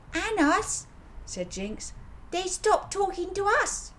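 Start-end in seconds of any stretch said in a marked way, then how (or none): 0.1-0.9 put-on child voice. A narrator's reading. Child Jinx's high voice. 2.3-3.9 put-on child voice. A narrator's reading. Child Jinx's high voice.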